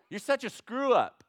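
loud, high put-down; hämisch schimpfender Gott; mock scolding God. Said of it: "what does God do".

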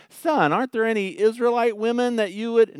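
high, loud acted-out father's appeal; hammed-up parental protest; pleading dad. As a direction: appeal to a wayward son. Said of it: "And Dad says".

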